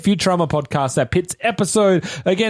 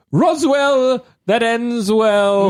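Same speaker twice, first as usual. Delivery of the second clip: slow, grand title read; slow, showy title announcement; announcer title; 5 semitones higher, much slower than usual. Acted. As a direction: roll out the title like a ring announcer.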